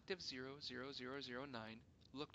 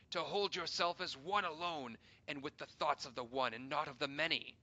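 angry accusation; 4 semitones higher, 11 dB louder than usual. Acted. Councillor accuses the hero.

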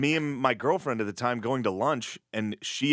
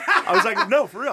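laughing; 8 dB louder than usual. Retold or imitated. Laughing denial to agent.

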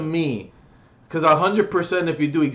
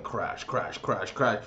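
low, soft repeated crashes; hushed, dropping sound-effect words; slow crash; 7 semitones lower, 11 dB quieter, slower than usual. Retold or imitated. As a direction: mimic a gradual crash under your breath. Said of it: "it was a slow, like".

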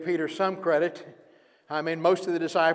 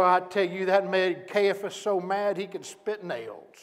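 ordinary delivery

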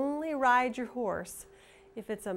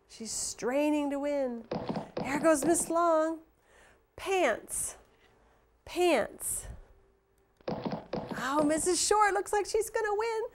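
higher, fast-rising race commentary; excited race call; 7 semitones higher than usual. Pretend. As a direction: call the finish like a racing announcer. Calls the pretend horse race.

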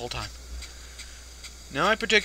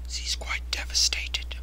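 whisper; 3 dB quieter, slower than usual. Pretend. Mock sad narration of sprite.

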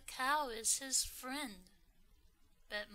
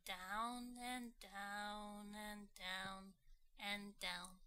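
drawn out descent; 7 dB quieter, slower than usual. A narrator's reading.

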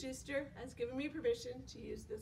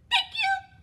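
tiny high squeak, much louder; high squeaky mouse; 12 dB louder than usual. Acted. Little mouse thanks the giraffe in a high squeak.

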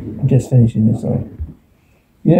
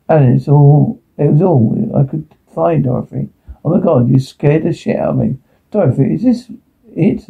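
normal talking voice

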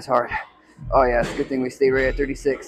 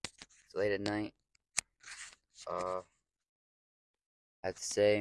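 tired and much softer; exhausted; 11 dB quieter than usual. Real, not acted. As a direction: wind down, spent after the workout. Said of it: "I am so whooped and tired".

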